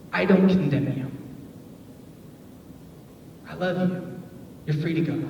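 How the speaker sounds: quiet and soft; tender words. Retold or imitated. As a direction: speak it with love. Jesus speaks tenderly.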